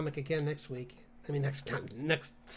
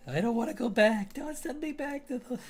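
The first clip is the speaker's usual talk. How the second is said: high, hammed-up begging; mock pleading; 8 semitones higher than usual. Begging not to return to the cartoon era, mock pleading. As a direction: play-act dread, pitch climbing as you plead.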